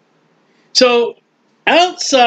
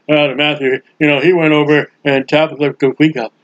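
mocked preacher; 6 semitones lower than usual.

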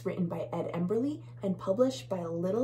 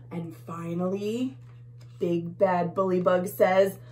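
ordinary delivery, nothing marked

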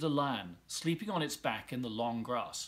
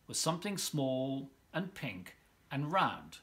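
plain reading voice

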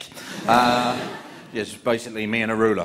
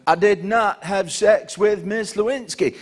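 slow, deliberate Clinton denial; measured presidential denial; Bill Clinton impression; 8 semitones higher, slower than usual. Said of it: "he goes".

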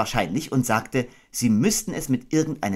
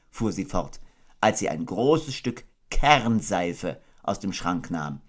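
ordinary delivery

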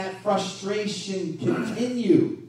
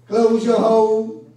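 loud command; 3 semitones higher, 10 dB louder, slower than usual. Acted. Preacher commands the congregation.

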